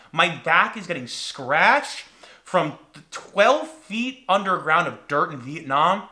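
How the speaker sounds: ordinary talk, nothing marked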